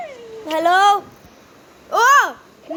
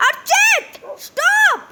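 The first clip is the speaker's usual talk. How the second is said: shouting; 8 semitones higher, much slower than usual. Real, not acted. Shouting at friend to stop.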